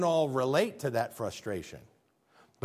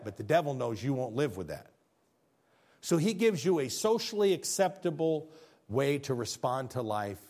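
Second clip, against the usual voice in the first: ordinary talk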